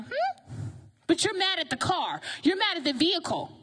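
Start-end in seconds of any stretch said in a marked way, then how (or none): 0.0-0.7 high, soft questioning hum; puzzled hmm. Acted. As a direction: hum it like a baffled onlooker. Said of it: "the person outside the car looking like".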